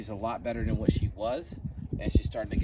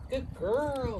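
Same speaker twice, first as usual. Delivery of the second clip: high squeaky praise for the dog; 12 semitones higher than usual. Acted. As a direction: squeak it up and slow, praising a puppy.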